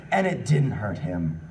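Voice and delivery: ordinary talk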